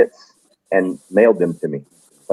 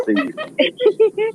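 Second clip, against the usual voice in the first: laughing. Real, not acted. Joking about running past camera.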